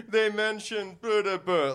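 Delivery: high, slow imitation of a giggling audience member; mock laughing blurt. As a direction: fake laugh, then blurt it out. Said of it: "say it out loud like".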